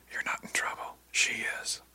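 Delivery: hushed secret whisper. Acted. Diane warns Ish about Brill in a whisper. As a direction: breathe it so Brill won't hear. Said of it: "she whispered".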